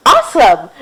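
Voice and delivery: loud thrilled exclamation; amazed cheer. Acted. Rudy hearing Samuel's meaning, thrilled cheer. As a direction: burst out with wonder.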